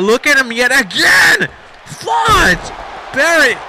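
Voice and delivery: high, loud burst of commentary; shocked excited call. Real, not acted. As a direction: cry out at the crushing hit. Commentator reacts to big splash.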